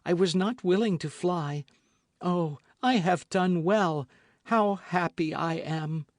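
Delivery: lightly voiced joyful young woman; Constance voiced. A narrator's reading. Constance to the musketeers, voiced with relief.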